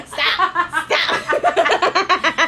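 loud, high cry through laughter; laughing protest. Real, not acted. Woman to co-host mid-joke, laughing shout. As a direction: beg for it to stop while cracking up.

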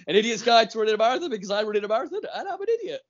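high, quick, through laughter; laughing mockery. Real, not acted. Co-host teases him over the idiot's guide, laughing. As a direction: crack up while teasing him.

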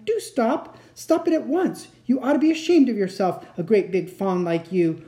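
high, exasperated telling-off; bawling scolding. A narrator's reading. Lucy shaking the crying fawn, scolding. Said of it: "bawled Lucy in his ear".

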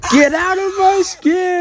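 high wailing shout copying the video; slow, loud mock crying yell; echoing the clip. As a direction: scream it like the kid in the TikTok. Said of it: "I should do that in public".

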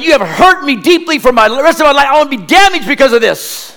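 high, loud mock-wounded wail through laughter; boy accusing his mom, laughing; melodramatic kid. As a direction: ham up the hurt child. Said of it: "I said".

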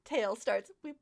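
ordinary delivery

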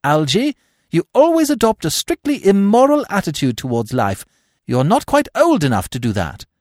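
put-on high, loud imperious young lady; high-pitched, emphatic reproof; Gwendolen, scolding. A narrator's reading.

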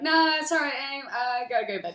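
high, put-on brush-off voice; hammed-up awkward husband; evasive husband. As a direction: dodge her with a forced lightness.

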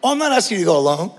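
ordinary delivery

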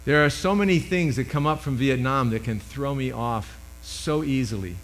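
usual speaking voice

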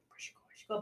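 barely voiced repeated question; mock whisper of a son; whispered kid voice. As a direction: whisper it twice, a small boy searching. Imitating son asking again.

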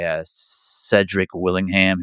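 usual speaking voice

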